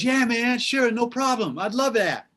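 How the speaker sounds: high, airy easygoing star impression. Singer saying yes to an old song, high impression. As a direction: agree sweetly in a high-pitched voice. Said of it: "That's my [name] impression".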